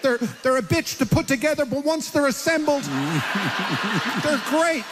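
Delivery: lightly hammed-up pitching jets; arguing into his head. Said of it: "And I'm, like, saying".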